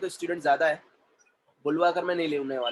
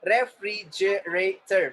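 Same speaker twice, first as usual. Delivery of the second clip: very slow, separated syllables; much slower than usual. Shown as a demonstration. Teacher modelling syllables for the class, syllable by syllable. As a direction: break the word into slow beats.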